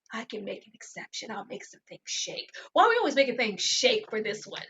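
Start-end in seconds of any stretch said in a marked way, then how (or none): 0.1-2.5 hushed conspiratorial whisper; secretive whisper. Retold or imitated. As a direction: breathe it like a secret deal. Sneaky favor, confidential aside.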